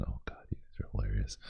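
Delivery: a fast, amused whisper aside; real whisper. Real, not acted. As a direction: murmur it under your breath, amused. Amused aside away from mic.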